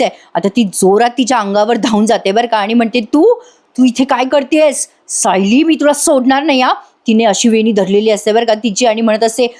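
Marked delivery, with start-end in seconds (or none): 3.0-6.7 lunge at her with rage; mock furious confrontation. Priya charges at inmate.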